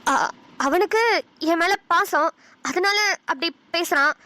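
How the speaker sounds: halting, broken phrases; hesitant defence. Acted. Mother defending son's attachment to her, hesitant and halting. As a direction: falter between each phrase.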